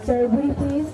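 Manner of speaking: normal talking voice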